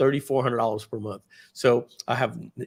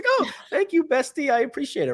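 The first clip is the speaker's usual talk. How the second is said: much higher, gushing put-on voice; imitating the wife; 13 semitones higher than usual. Wife thanking herself for her own purchase, very high. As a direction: gush thanks to yourself, very high. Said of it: "she's like".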